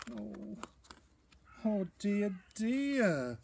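high-pitched, growing storybook dismay; sighed dismay. A narrator's reading. Bear after his second sneeze, high sighed dismay. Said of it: "sighed Bear".

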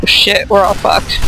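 normal talking voice

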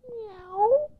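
high grateful mew; kitty meow. Acted. A kitten thanking the lady with a mew. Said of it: "the little kitty says".

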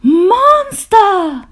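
high, loud excited cheer. A narrator's reading. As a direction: cheer it out at full voice. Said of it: "they all cheered together".

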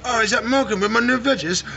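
high, eager voiced lizard hoping for veggies; voicing a lizard. Keeper voicing the lizard greeting Morgan, high and eager. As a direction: play the lizard spotting Morgan with food. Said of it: "He's like".

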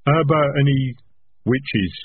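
lightly voiced highwayman at the coach window. Highwayman questions coach passengers from outside.

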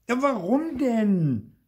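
high, loud, drawn-out shout. A narrator's reading. Sister demands to know why he hides, shouting. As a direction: yell the question in exasperation. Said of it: "schrie jetzt meine Schwester Clara".

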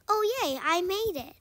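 loud, high cheer; delighted. Real, not acted. Player clears the obstacle course, delighted. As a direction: celebrate a small victory out loud.

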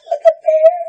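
high, loud cry of delight; tearful squeal. Real, not acted. Showing a friend's gift to viewers, tearful squeal. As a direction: squeal through happy tears. Opening gift, overwhelmed with joy.

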